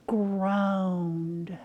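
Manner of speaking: very slow, groaning stretch on GROANED; drawn-out groan. Retold or imitated. Telling the class that Jesus groaned, groaning the word slowly. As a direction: draw the word out like a long groan.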